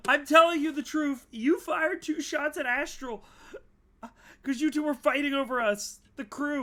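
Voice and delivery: high, loud, desperate; terrified crew member insisting to the shapeshifter; panicked insisting. Acted. Panicked character insisting on truth.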